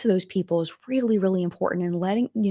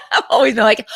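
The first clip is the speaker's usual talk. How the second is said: laughing; 7 dB louder than usual. Real, not acted. Laughing at calling herself emotional.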